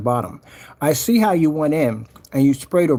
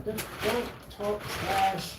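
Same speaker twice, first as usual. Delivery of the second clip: soft slow warning; 7 semitones higher, 10 dB quieter, much slower than usual. Acted. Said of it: "when I tell you that, in that voice".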